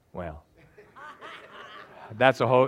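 ordinary talk

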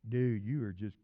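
low, groggy mumble to the dog; acted druggy voice; messed up on drugs. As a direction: imitate someone wasted. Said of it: "totally drug messed up saying".

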